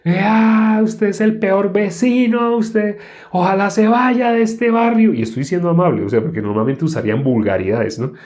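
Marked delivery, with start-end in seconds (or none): 0.0-5.1 high, slow mock tantrum; hammed-up angry neighbor. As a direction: whine it like an offended neighbor. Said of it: "empieza a hacer una pataleta".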